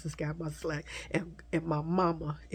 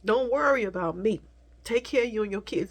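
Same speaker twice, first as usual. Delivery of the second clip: higher, louder mother's voice, tearful at times; mother's comforting voice; 5 semitones higher, 4 dB louder than usual. Retold or imitated. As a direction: soothe her child as her mom did. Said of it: "she would tell me".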